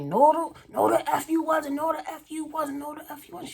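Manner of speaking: high, repeated mock denial; high retold heated denial; angry denial. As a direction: deny it over and over, heated. Ex denying the other woman.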